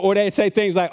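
usual speaking voice

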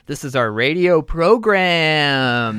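radio host voice. Acted. Mock radio host intro.

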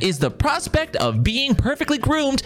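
high, mock sports-broadcast hype, last words stretched; peppy co-host announcer. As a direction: play the excited second commentator. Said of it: "I'm the really, like, peppy".

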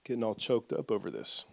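soft, low, apologetic and choked up; hushed, catching yourself as you choke up. Real, not acted. Preacher apologizes mid-reading for getting emotional, choked up. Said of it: "I'm about to choke up here".

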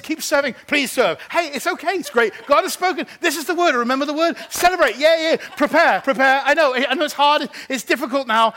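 rally the tired volunteers to keep going; fervent preaching. Acted. Pastor urging his tired church to keep serving, fervent preaching.

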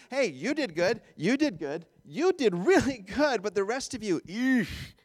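exaggerated singsong praise, slowing, then a disdainful eesh; mock selective praise. Preacher parodies what Paul isn't saying, mock praise. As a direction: hand out praise, then recoil.

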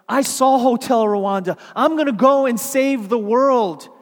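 loud, rising preacher's exhortation; preaching at full force. Acted. Preacher rousing young listeners, loud and fervent. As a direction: build the sermon to a loud climax.

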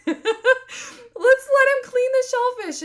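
high, loud, drawn-out laughing delivery. Real, not acted. Mother retelling her child's shellfish fix, laughing. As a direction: squeal it out through laughter.

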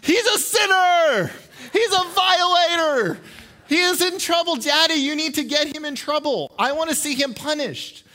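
high, loud mock scream of a child; screaming tattletale. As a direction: shriek it like an outraged little sister reporting to dad. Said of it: "she screams out".

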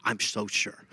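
lightly voiced Valley Girl disbelief, low and soft. Preacher imitating scoffing grandkids, a hint of Valley Girl. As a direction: toss it off as a mild mock Valley Girl. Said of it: "why they'd be a Valley Girl".

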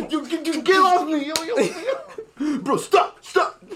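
laughing and struggling in a headlock. Pretend. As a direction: squeal get off me while grappling. Play fight, being grabbed.

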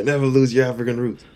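quick, emphatic imitation of a mother's advice; quoting mom. Actor repeating mom's advice to the host, lightly imitating her, emphatic. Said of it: "my mom was like".

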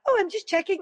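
very high, jolly sing-song nurse; really jolly. Retold or imitated. Nurse cheerily explaining her checking, very high and jolly. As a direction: chirp it like an overly cheerful nurse. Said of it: "you know, really jolly".

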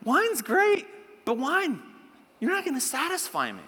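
high, mock-stern telling-off; attitude. Shown as a demonstration. Preacher letting wine down before the congregation, with attitude. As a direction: start high and punchy, ease into dismissal. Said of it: "say it with a little attitude".

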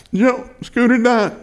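high, drawn-out mock weeping; imitated crying. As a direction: act the tearful wife, high and slow. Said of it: "She's crying".

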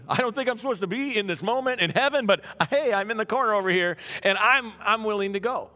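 high, loud and eager; Isaiah eagerly volunteering, high and loud. Retold or imitated. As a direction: jump at the call like a keen volunteer. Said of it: "He's like".

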